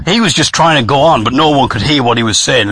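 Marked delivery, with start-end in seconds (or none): none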